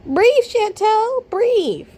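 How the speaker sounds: high, drawn-out plea to the screen; mock urgent coaching. Urging wheezing woman through screen.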